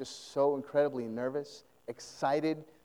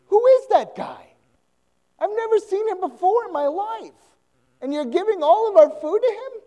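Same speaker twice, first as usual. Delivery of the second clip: much higher, put-on voice of a baffled young son; son's protest; 9 dB louder than usual. Son protesting to his mother about the food, high childlike voice. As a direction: squeal it like a kid who can't believe his mom. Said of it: "the son is standing there being like".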